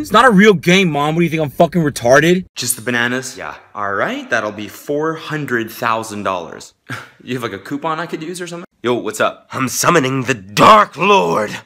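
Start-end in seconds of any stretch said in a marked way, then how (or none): 0.0-2.4 high, loud retort; irritated kid. Acted. Kid answers mom about game, loud indignant. 9.5-11.6 slow, loud invocation voice; ominous summoner. Acted. Roommate summoning Dark Lord, slow loud ominous voice.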